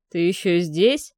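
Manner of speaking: calm threat of a man in control; confident. A narrator's reading. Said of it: "уверенный голос хозяина дома". (уверенный, медленный голос)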